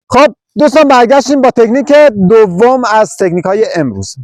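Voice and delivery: loud, high comeback for technique two; high, loud TV-host welcome back; upbeat host announcement. Real, not acted. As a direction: launch the segment with showman energy. Host opening second technique.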